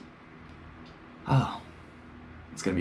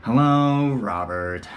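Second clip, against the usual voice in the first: flat teacher greeting; 3 semitones higher, 6 dB louder, much slower than usual. Retold or imitated. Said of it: "sound just like this".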